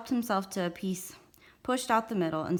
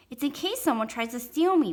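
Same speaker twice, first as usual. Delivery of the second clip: put-on high-pitched kid explaining; proud little brother; 6 semitones higher, 5 dB louder than usual. A narrator's reading. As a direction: explain it eagerly as a small boy.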